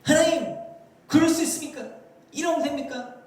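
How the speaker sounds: high, indignant mock protest; acted-out blaming prayer, pitched high; accusing God. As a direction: hurl accusing questions upward. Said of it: "하나님을 비난하고 손가락질하는 것입니다".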